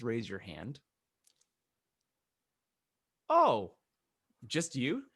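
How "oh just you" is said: high, feigned surprise; playful rising question; mock surprise. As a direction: act surprised at the lone volunteer. Mock surprise at the raised hands.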